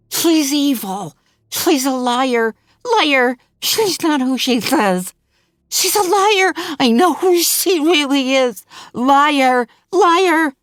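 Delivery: high, loud raging shout building up; enraged intruder screaming at the mother; furious accusation. Acted. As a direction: hurl each "liar" at her. Diane raging at Hannah.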